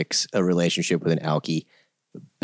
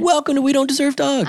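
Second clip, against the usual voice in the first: upbeat show opener; podcast host intro; faster than usual. Acted. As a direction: announce the show brightly.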